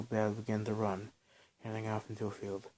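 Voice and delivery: ordinary delivery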